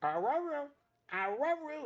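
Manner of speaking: high dog-voiced I love you, r for l; a dog straining to say words; squeaky puppy trying to talk. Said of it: "they say, "Mama," and "I love you".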